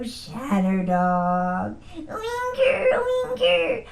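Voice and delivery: whipping the dog into a frenzy with dog talk. Retold or imitated. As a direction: stretch it out then squeal Winger. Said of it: "and I'd go".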